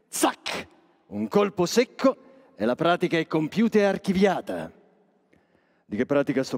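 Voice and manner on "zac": a breathy zac like a quick blade; voiceless slicing noise; whispered cutting sound. Acted. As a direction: mime the snip with air only. Imitating a quick cut.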